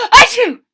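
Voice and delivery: loud gasping achoo; big sneeze. A narrator's reading. Wilbur sneezes in the girl's room, a huge loud achoo.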